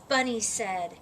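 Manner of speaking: ordinary delivery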